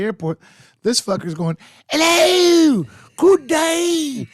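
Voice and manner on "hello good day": high, loud hammed-up British greeting; overdone posh British hello; mock British accent. TSA guy hears the bad British accent. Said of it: "I don't do a good accent".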